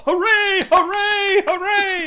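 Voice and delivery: high loud cheering; mock jubilant triple cheer; cheering hooray. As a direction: shout hooray like a delighted winner. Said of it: "he gets all".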